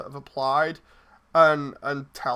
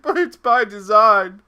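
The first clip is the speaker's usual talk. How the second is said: loud, higher exasperated retort; mock protest; 5 semitones higher, 10 dB louder than usual. Host acts out answering complaints, mock exasperation. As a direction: protest it loudly, in jest.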